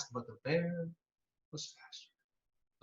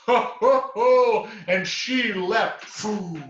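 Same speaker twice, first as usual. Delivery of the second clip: loud, high booming "Ho, ho, ho"; bear laugh; 8 semitones higher, 11 dB louder, much slower than usual. A narrator's reading. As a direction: belly laugh like a huge bear. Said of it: "The bear laughed".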